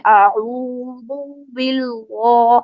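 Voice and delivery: باللہ dragged out very slowly with a heavy, thick lam; exaggerated heavy باللہ; overly forceful recitation. Shown as a demonstration. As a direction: mimic the strained way people recite اعوذ باللہ. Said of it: "اتنی شدت کے ساتھ اس کو پڑھتے ہیں".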